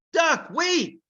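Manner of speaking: high urgent call of warning; shout. Acted. Ilyas shouting to stop Duck eating, urgent shout. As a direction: stop your friend mid-bite. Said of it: "Ilyas had to shout".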